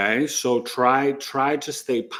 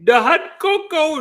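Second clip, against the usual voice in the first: higher and louder, slow; mock cutesy voice; silly put-on voice; 6 dB louder than usual. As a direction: stretch out the hot cocoa in the squeaky voice. Same put-on voice returns.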